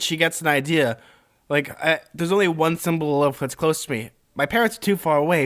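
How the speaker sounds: ordinary talk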